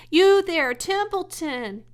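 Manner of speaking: high, loud call across the yard. Acted. Wilbur calling Templeton from the yard, loud call. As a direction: call the rat out from his hole. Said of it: "he called".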